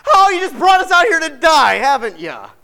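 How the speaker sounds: mock the whining crowd; whiny complaint. Preacher mocks the people whining at Moses, high and loud.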